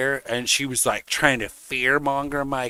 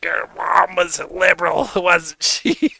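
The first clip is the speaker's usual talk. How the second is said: mock taunt; 6 semitones higher, 4 dB louder than usual.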